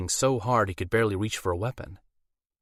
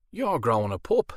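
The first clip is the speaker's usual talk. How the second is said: Irish werewolf; 3 semitones higher than usual. A narrator's reading. Werewolf explains his overbite to Alora in Irish accent. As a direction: plead it in a thick Irish accent.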